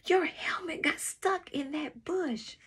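put-on high-pitched child character voice; friend calling out. A narrator's reading. Kiki to the rider who threw the helmet, calling out. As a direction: call it out in a high voice.

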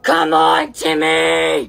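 loud, drawn-out yell; exasperated shout. Acted. Pushing Timmy to remember, exasperated shout. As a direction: yell at him to get it.

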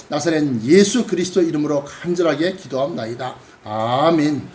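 earnest, low-pitched public prayer. Real, not acted. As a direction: lead the congregation in formal prayer. (간절한 대표 기도)

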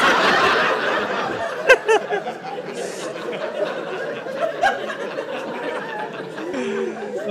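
breathless high giggling; long high laugh. Real, not acted. Laughing hard after mimicking his toddler. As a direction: collapse into helpless laughter.

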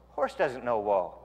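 usual speaking voice

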